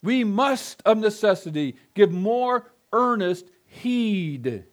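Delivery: slow, weighty stress on "earnest"; emphatic command. Shown as a demonstration. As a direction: drive home the duty. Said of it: "with loud, flashing emphasis".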